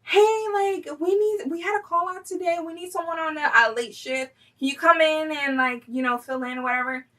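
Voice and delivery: high-pitched mock manager on the phone; imitated boss calling in a worker; imitating manager. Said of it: "my manager, she calls me".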